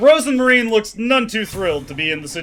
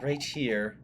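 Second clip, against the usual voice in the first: lower, softer entranced voice; soft eerie lure; 3 semitones lower, 8 dB quieter than usual. Acted. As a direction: hush her into the embrace. Rosemarine luring softly.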